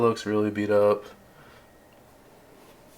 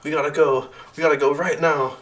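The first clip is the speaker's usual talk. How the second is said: higher-pitched, restrained yell; urgent yell; 6 semitones higher, 3 dB louder than usual. Acted. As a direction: panicky insistence, stumbling at the start. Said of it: "And then she yells at you".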